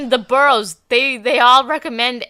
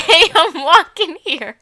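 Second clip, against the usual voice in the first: put-on slow, louder New York cabbie accent through giggles; 7 semitones higher, 5 dB louder than usual. Redoing the New York accent on request, laughing. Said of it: "Just do it one more time".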